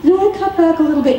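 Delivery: wife mimicking her husband's weak excuse; evasive husband. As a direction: toss it off like a husband dodging the question. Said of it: "kind of say".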